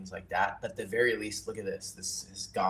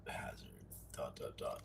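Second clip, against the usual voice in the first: whispering; 8 semitones lower, 14 dB quieter than usual. Real, not acted. Reading aloud while writing.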